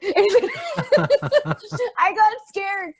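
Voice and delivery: slow, laughing it out. Real, not acted. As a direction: laugh through the words. Laughing about getting scared.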